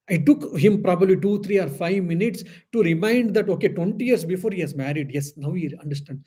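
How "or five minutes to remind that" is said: usual speaking voice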